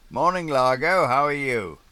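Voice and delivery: bright, high and loud hail over the radio; radio call greeting. Acted. Sailor hailing Largo over the radio, high and loud. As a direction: hail the other yacht cheerily.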